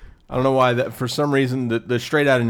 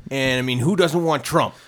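put-on Trump accent; 5 semitones higher than usual. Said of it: "How did Trump ... that weird accent?".

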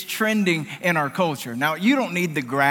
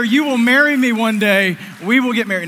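loud, high, insistent mock vow; mock declaration; 6 semitones higher, 9 dB louder than usual. Joking about what he told his future wife mid-hug, mock-insistent and loud. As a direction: declare it loudly, pitched up, as a joke.

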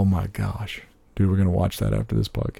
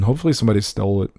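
usual speaking voice

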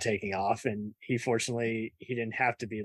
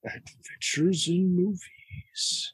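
slow, drawn-out, higher-pitched trailer announcer; movie trailer voice; 8 semitones higher, 3 dB louder, much slower than usual. Acted. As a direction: boom out the title like a movie trailer. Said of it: "I'm just doing a movie trailer voice".

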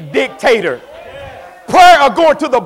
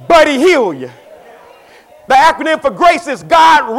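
loud, high-pitched preaching; 5 semitones higher, 4 dB louder than usual. Acted. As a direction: preach it out, higher and louder. Preacher at full force.

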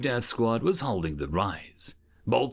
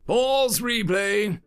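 high, drawn-out command; commanding instructor; 9 semitones higher, 4 dB louder, much slower than usual. A narrator's reading. Educator halting the combat recording, high slow command. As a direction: call it out slowly to the room.

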